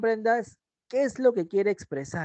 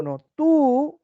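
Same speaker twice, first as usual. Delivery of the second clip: "tú" said higher and louder; emphatic tú; 8 semitones higher, 5 dB louder than usual. Shown as a demonstration. Teacher starting the capital-letter example. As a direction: jab the word "tú". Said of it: "con mayúscula".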